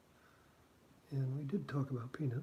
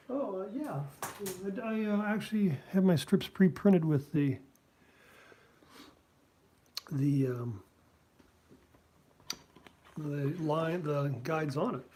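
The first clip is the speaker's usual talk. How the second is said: sheepish self; 3 semitones higher, 5 dB louder than usual. Retold or imitated. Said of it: "I'm like".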